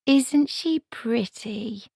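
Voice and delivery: slow, high, sighing wistfully. A narrator's reading. Margaret sighs over the sleeping girl, slow and high. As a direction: let it out on a slow sigh. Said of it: "sighed Margaret".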